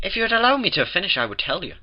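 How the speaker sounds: lightly voiced Mrs Cake asking patience; mildly miffed baker. A narrator's reading. Mrs Cake asks Twilight to let her finish, miffed.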